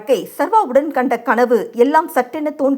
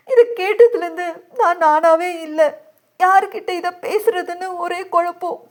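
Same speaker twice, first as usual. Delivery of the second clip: high, plaintive crying voice; weeping woman confiding in a friend; tearful; 6 semitones higher than usual. A narrator's reading. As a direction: pour out helpless distress through tears. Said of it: "அஞ்சுவின் அழுகை இன்னும் அதிகரித்தது".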